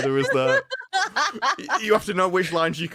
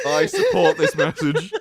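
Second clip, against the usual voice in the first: laughing; 5 dB louder, slower than usual. Real, not acted. Laughing at friend's request.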